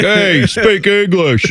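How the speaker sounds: high, slow caricature New Yorker; mock New York accent. Host mocking New Yorkers who deride accents, exaggerated New York accent. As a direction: demand it like a cartoon New Yorker. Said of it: "people from New York do the same".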